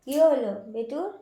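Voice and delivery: high, sing-song pet talk; cooing high baby talk to a pet; baby talk to the cat. Acted. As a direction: coo at the sleepy kitten in a high voice.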